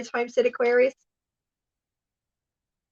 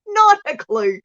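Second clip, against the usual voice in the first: laughing; 9 semitones higher, slower than usual. Real, not acted. Laughing denial.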